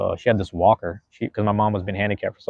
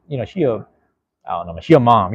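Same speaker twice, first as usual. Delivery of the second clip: ordinary talk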